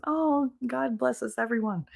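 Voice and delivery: put-on goody-goody voice; saintly innocent. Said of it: "I've always been like this".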